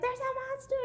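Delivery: high, loud mock scream of a frightened little girl; scared kid shout. Little girl yelling to her parents about the monster under her bed, hammed-up and high. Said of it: "and she's like".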